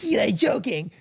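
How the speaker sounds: hammed-up American twang. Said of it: "he had that kind of twang".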